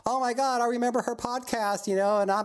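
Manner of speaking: put-on breathless admiring girl voice; gushing fan girl. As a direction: squeal like an excited young fan. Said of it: "They'll be going".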